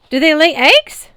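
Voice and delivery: high, loud excited asking; eager question. A narrator's reading. Big boy asking about eggs, high excited voice. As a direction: ask with childlike glee.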